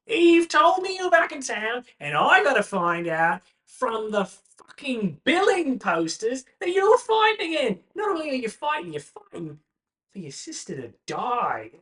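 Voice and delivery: high, loud exasperated rant; scolding friend. Acted. Paxton scolds Branwen after tackling her, loud and high. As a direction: chew out your buddy, loud and high. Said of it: "finally tackles you to the ground and goes".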